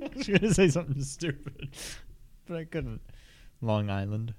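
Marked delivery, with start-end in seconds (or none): 0.0-3.0 quick words through a laugh. Real, not acted. Co-host admitting a stupid joke to host, laughing. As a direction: giggle your way through it, pitched up.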